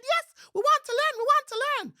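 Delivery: very high, loud, squealing monkey cry; thrilled little monkey spotting a visitor; excited monkey. A narrator's reading. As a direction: shriek with delight like a little monkey. Monkeys pleading.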